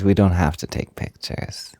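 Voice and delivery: ordinary delivery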